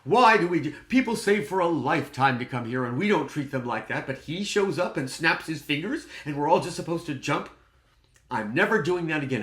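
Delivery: loud, high indignant protest; acted outrage at full volume; self-righteous outburst. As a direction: re-enact venting backstage about a guest. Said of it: "became all self-righteous with myself".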